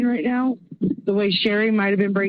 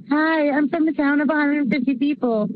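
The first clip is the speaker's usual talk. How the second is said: mock silly Midwestern accent; 6 semitones higher than usual. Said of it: "And a silly Midwestern accent".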